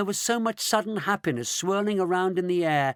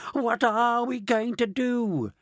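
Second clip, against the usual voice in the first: high, slow mock wail; 8 semitones higher, slower than usual. A narrator's reading. Grandma Georgina lamenting to the others, slow high mock wail. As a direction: whine it out in mild despair. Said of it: "wailed Grandma Georgina".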